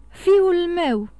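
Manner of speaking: high, loud burst of alarm; anguished shout, falling at the end; sudden cry. A narrator's reading. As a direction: cry out all at once. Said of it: "strigă el dintr-o dată".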